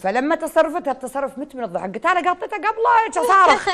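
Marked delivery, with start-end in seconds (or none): 2.1-3.7 excited shout. Retold or imitated. Retelling her shout at the singer.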